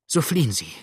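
mildly desperate plea; desperate pleading. A narrator's reading. Adele begging James to get away, desperate pleading. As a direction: urge him imploringly. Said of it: "flehte Adele jetzt in wilder Verzweiflung".

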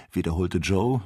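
plain reading voice

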